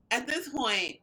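usual speaking voice

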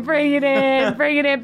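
tearful warmth. Acted. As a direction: pull him close through tears. Tearful hug, calling him in.

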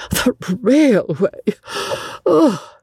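high, drawn-out mirthful gasp; scandalized gasp of glee. A narrator's reading. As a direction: gasp it out slowly, pitched high in scandalized amusement. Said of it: "gasped Miss Lavish".

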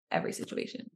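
every situation whispered, voiceless and soft; whispered aside. Real, not acted. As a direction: drop it to a whisper under your breath. Repeats phrase under breath.

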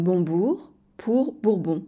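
slow, careful "Bonbourg pour Bourbon" with each syllable spelled out; "Bonbourg pour Bourbon" articulated slowly for learners; syllables clearly shown. Shown as a demonstration. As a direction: say "Bonbourg" then "Bourbon" slowly, letting the swapped syllables be heard. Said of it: "des exemples d'inversion de mots".

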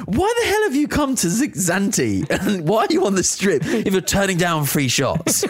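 high, disbelieving mock outrage with a laugh; incredulous onlookers. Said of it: "everyone's looking at us going".

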